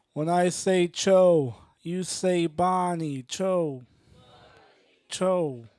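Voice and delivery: slow, punchy crowd chant lead; call and response. Acted. Comic leading the audience in a Chobani chant, slow emphatic calls. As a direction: draw out each call for the crowd.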